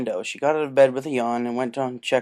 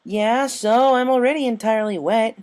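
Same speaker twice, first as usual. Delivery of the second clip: carefree Rainbow; 10 semitones higher, 8 dB louder than usual. Acted. Rainbow just giggled.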